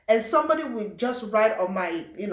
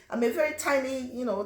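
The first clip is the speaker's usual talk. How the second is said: normal talking voice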